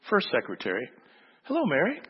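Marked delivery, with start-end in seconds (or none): 1.4-2.0 put-on high, bright small-boy greeting; little boy voice. Pastor's little son greeting the secretary, high child voice. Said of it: "small voice say to this".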